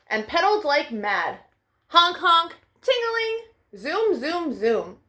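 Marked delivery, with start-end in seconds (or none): none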